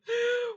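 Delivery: high wailing sob burst; hammed-up sob. Priest bursts into a mock howl of grief. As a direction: let out a comic howl. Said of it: "这俩都哭了".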